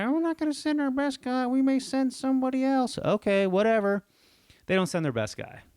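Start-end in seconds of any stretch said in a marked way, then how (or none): 0.0-3.0 high, breezy put-on clerk voice; mock store clerk. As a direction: toss it off like an unbothered clerk. Store staff voiced dismissively.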